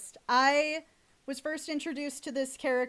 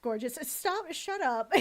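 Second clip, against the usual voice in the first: ordinary talk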